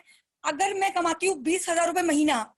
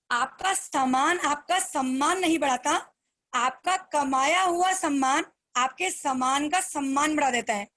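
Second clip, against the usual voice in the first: slow key line. Shown as a demonstration. Speaker to her team, repeating the motto slowly. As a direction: deliver the motto like a final lesson. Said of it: "फिर ध्यान से line सुनो".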